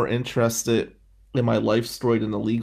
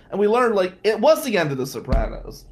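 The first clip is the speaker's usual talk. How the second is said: ordinary talk, nothing marked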